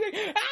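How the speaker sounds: high-pitched mock scream; imitated scream. Imitating him screaming as the car honks, high mock scream. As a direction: squeal out a comic scream. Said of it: "he just screams".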